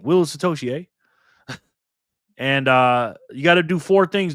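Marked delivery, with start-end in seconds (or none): 0.0-1.5 mock Canadian. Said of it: "Sound like a Canadian".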